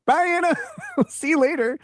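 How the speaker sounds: high, sing-out goodbye; mock cheery farewell. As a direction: call after her in a high teasing voice. Acted farewell as she storms off.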